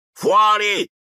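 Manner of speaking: uncle's furious roar; roared order. A narrator's reading. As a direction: roar them out. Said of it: "ruggì zio Vernon".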